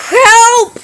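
high loud call for help; desperate shout; cry for help. Acted. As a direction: yell for rescue. Calling for help.

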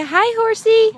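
put-on toddler voice. Prompting child to say hi.

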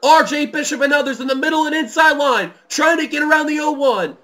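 high, loud race call; live play-by-play. Real, not acted. Race commentator calling the closing laps, excited play-by-play.

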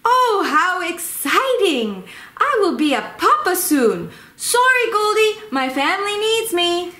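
a proud new dad about to fly home; excited. Pretend. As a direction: bird hears his babies are hatching, thrilled and eager. New papa hears eggs hatching.

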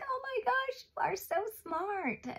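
high, gushing amazed voice; amazed family member. Pretend. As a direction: gush like an impressed parent. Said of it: "They're gonna be like".

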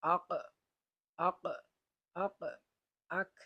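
আক said with a hamza and fatha before the qaf, short and hard; practice aq. Shown as a demonstration. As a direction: say আক to reach the qaf sound. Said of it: "হামজার উপরে জবর দিয়ে এইভাবে পড়তে পারো". (হামজা দিয়ে আক অনুশীলন)

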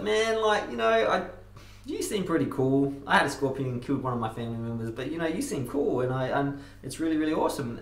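high, friendly frog voice; frog character voice. Acted.

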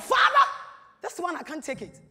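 sudden high, loud cry of anger; angry shout to God. Retold or imitated. Wife alone in her room, crying out in anger to God. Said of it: "I shouted out of anger".

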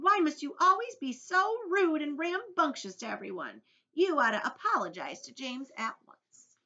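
high, loud, indignant scolding; Earthworm. A narrator's reading. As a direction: tell off a bully in outrage.

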